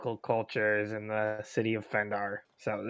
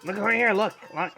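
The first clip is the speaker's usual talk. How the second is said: higher, louder call to look over here; 9 semitones higher, 3 dB louder, faster than usual. Acted. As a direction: grab his attention, loud and eager. Player calling Democritus to look.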